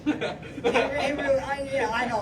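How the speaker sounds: laughing through words; cracking up mid-sentence. Real, not acted. Laughing at his own joke.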